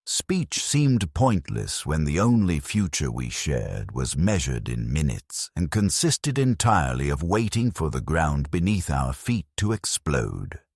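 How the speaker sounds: ordinary delivery, nothing marked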